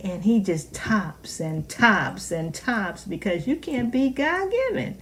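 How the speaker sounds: usual speaking voice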